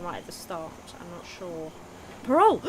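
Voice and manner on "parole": excited shout. Real, not acted. Excited yelling at the game.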